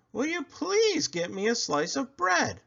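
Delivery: a mom asking nicely; mother voice. Acted. Mother asking Buddy for bread, high and sweet.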